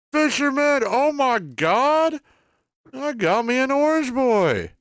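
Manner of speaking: high, loud excited yelling; excited shriek. Real, not acted. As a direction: squeal with delight at the catch. Excited win on stream.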